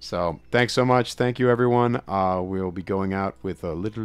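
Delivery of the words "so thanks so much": ordinary talk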